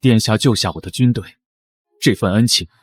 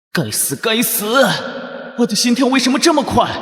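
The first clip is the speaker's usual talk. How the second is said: flustered panic; 10 semitones higher than usual. Acted. Flustered inner monologue.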